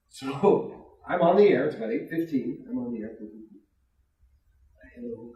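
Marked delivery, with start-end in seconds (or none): 5.0-5.3 lightly voiced Ronald Reagan, soft and breathy; a hint of an old president on a prank call; Reagan impression. DJ pranking the station as Reagan, soft and lightly voiced. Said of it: "thank them as Reagan".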